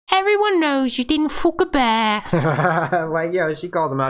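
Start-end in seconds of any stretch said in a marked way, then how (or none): 0.1-2.2 high mocking woman's voice, calling him out; Ygritte voice. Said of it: "Ygritte goes". 2.3-3.1 laughing. Real, not acted. Laugh after quote.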